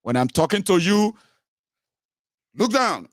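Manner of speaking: high, stern order; acted high-pitched drill command; commanding master. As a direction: order them to submit. Acting a master giving orders.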